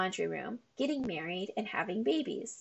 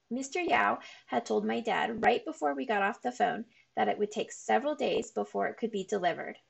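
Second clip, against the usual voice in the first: ordinary delivery, nothing marked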